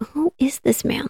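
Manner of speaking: hushed whisper, unsure who the stranger is; whispered puzzled thought. Retold or imitated. Gracie wondering about the man in her parents' room, whispered. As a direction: breathe it like a private question.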